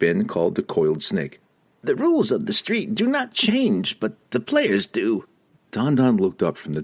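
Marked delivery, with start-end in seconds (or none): 1.8-5.2 very high squeaky reply; halfling voice. Acted. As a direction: chirp it out high. Don Don speaking.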